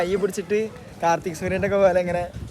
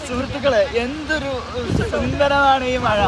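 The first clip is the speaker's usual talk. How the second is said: slow, raised orator voice; mock formal speech; 6 semitones higher, slower than usual. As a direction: address the crowd like a politician.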